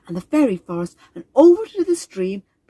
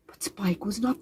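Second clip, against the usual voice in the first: whisper; 10 dB quieter than usual. A narrator's reading. Suspenseful turn in story.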